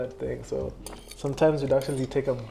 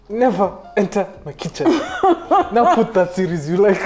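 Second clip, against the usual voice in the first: mom's scolding said louder and higher through laughter; laughing mock scolding; 6 semitones higher, 8 dB louder than usual. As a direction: scold playfully while cracking up. Mom scolding, retold through laughter.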